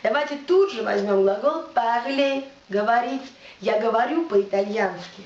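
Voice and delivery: ordinary delivery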